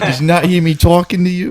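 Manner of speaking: put-on high Boston accent, irritated; mock Boston nag. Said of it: "a Boston accent".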